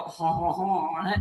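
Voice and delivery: mock French "haw-haw-haw" laugh, pitched low; lightly put-on French chuckle; French accent. Shown as a demonstration. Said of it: "That's my French accent".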